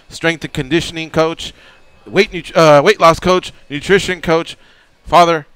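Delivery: loud, high hype host intro; radio announcer. Acted. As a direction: sell the show like a big announcer. Host's big show-opening introduction.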